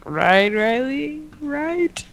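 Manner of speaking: high, slow squeaky plea; teasing high-pitched right; mock squeaky appeal. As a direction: draw it out in a high silly voice.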